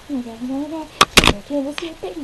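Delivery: ordinary talk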